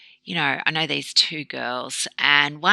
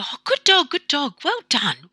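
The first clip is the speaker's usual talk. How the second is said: much higher, gushing praise to a dog; over-the-top praise; 12 semitones higher, 4 dB louder than usual. Retold or imitated. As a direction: lay the praise on thick, like cooing at a puppy. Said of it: "over the top praise".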